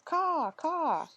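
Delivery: high cawing raven call. A narrator's reading. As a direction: caw like a raven. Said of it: "He became Raven once again".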